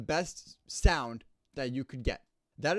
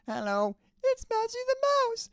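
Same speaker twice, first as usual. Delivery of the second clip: tiny high mouse voice, much higher; squeaky mouse voice; 10 dB louder than usual. Shown as a demonstration. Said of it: "you can see how well it works".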